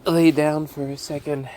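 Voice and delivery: weak and faint. Real, not acted. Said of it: "I'm dizzy".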